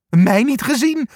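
high, small scaredy-hare voice; timid hare voice. A narrator's reading. Angsthaas refuses to climb out, high timid voice. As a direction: pipe up nervously, like a frightened hare. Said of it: "zegt Angsthaas".

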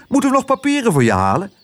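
snel, hoog vogelstemmetje; musje Toep. Acted. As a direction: vraag het rap en piepend hoog. (snel, hoog mussenstemmetje)

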